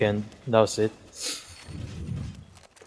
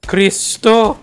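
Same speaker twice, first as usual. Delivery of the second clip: excited exclamation; 7 semitones higher, 7 dB louder than usual. Real, not acted. Gamer exclaiming mid-match.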